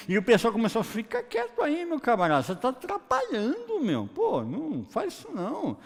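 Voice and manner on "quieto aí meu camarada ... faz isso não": mock scolding. Crowd hushing the blind man.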